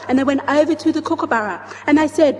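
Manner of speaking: usual speaking voice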